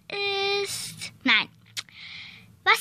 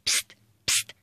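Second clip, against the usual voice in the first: unvoiced hushed call; whispered psst; 7 dB quieter than usual. Kid secretly calls Stami in class, whispered. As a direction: hiss for attention quietly.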